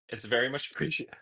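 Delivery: ordinary delivery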